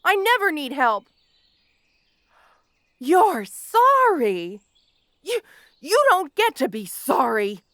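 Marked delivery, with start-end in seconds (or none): 3.0-7.6 incredulous scorn. Acted. Villain throwing his victim's apology back, slow scorn. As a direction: drag it out slow and louder, scornful.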